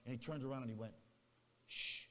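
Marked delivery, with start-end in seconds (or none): 1.7-2.0 demo shush. Shown as a demonstration. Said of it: "I'll do it this way".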